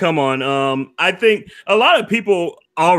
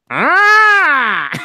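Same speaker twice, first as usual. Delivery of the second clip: high shriek; 11 semitones higher, 5 dB louder than usual. Pretend. Stitch request, sudden shriek.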